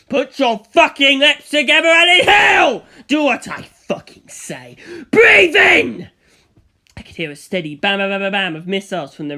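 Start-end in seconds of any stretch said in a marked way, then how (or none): 0.1-6.1 much louder, higher villain scream; furious screaming order. Acted. Dio screaming at Antonio to breathe in. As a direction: scream the command in a rage.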